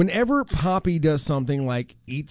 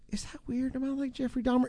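high, much softer, doubtful question; worried hush; 6 semitones higher, 10 dB quieter than usual. Retold or imitated. Host mimics coworker quietly asking if she is strange, high and hushed. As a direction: ask it in a small, unsure voice. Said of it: "a moment of clarity".

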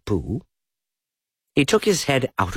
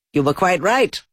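rabbit voice; 10 semitones higher, 3 dB louder than usual. A narrator's reading.

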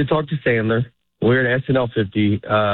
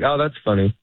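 quick brush-off, a touch faster; polite quick dismissal; a bit quicker. Retold or imitated. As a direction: toss it off quickly to escape the chat. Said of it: "You say it a little bit quicker".

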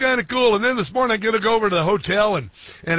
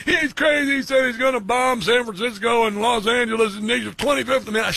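mocking Democrats; 6 semitones higher than usual. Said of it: "the Democrats are like".

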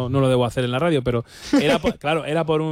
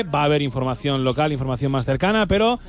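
higher-pitched on-air announcer read; radio promo announcement; 4 semitones higher than usual. Acted. Radio host urges listeners to tune in at two, promo announcer style. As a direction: announce what is coming up on the radio.

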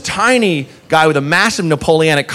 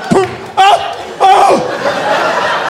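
much higher, breathless mock pain; mock pained gasp; 14 semitones higher, much slower than usual. Diner hit by a plate, high breathless gasp. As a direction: gasp as if just hit in the eye. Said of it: "just went".

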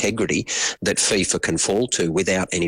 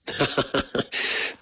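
brief wry chuckle before answering; short laugh; 7 semitones higher than usual. Real, not acted. Guest reacting to the host's question, short laugh.

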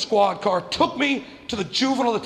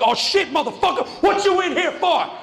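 mocking inmate; 10 semitones higher than usual.

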